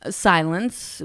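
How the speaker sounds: ordinary talk, nothing marked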